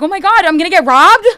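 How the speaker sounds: high, loud fake-alarmed shriek; hammed-up horror rising into a question; mock panic. Prankster winding up a phone scammer, mock panicked shout.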